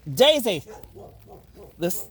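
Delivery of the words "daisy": loud call to the dog. Real, not acted. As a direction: shout the dog's name. Calling the excited dog.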